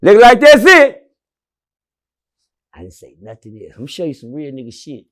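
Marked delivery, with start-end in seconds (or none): 0.0-1.0 slow, high, loud announcement; retold loud call. Announcing his release to the whole prison, loud and slow. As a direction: let it ring down the block. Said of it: "And it echoed".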